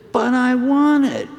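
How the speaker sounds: put-on a boy pleading for a toy, pitched high and loud; whining toddler. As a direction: wail it like a kid about to cry. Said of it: "and started to cry harder".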